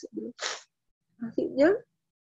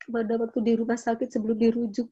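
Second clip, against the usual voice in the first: normal talking voice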